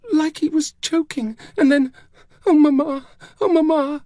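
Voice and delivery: put-on high little girl's voice breaking into cries; very high, anguished child; distraught child. A narrator's reading. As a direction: wail to mother as a grieving child.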